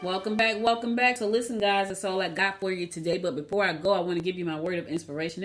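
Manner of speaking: ordinary talk, nothing marked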